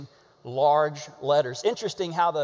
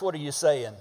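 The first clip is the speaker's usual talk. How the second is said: ordinary delivery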